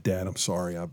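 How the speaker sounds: soft, low imitation of an upset son; choked up. Injured son calling his dad, quietly choked-up imitation. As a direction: retell it hushed, as if choked up. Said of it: "choked up".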